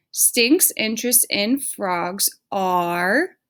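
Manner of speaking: slow, deliberate modeling voice; write-along pace for dictation; slow and clear. Shown as a demonstration. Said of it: "use that as our sentence starter".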